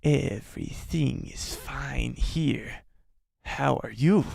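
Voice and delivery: slow, soft hissing reptile voice; drawn-out, hushed creature rasp; Trandoshan impression. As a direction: play a hero badly disguised as a lizard alien on comms. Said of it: "Ezra Bridger on the comms as a Trandoshan".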